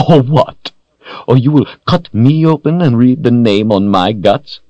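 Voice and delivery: high, loud, theatrical mockery; villain's incredulous sneer; mocking taunt. A narrator's reading. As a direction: throw the threat back in ridicule. Villain mocks the hero's threat.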